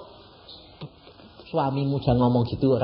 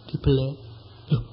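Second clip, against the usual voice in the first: ordinary talk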